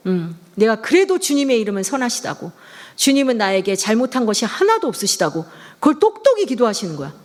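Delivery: ordinary delivery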